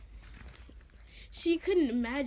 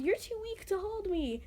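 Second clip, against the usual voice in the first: mild narrated yell; 8 semitones higher than usual. A narrator's reading. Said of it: "but she yelled".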